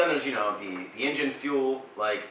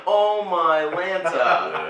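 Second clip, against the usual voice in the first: stunned disbelief; 6 semitones higher, 7 dB louder, much slower than usual. Retold or imitated. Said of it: "And we're like".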